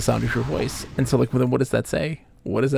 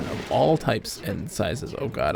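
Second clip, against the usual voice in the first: usual speaking voice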